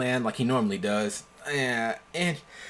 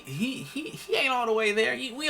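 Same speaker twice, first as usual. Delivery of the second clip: laughing; 11 semitones higher than usual. Real, not acted. Laughing about a crazy friend.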